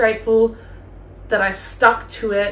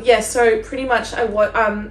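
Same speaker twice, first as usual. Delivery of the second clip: normal talking voice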